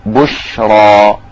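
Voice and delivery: بشرى drawn out very slowly with a full, heavy ر. Shown as a demonstration. Teacher shows students the heavy ر in بشرى. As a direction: stretch بشرى and thicken the ر. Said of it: "هنا الراء جاءت مفخمة".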